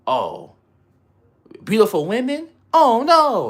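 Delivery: mock a prude clutching pearls; hammed-up shocked complaint; mock scandalized. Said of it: "no one in here complained about".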